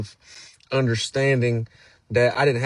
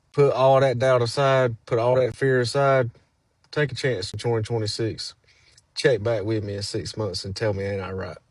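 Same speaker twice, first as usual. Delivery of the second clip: ordinary delivery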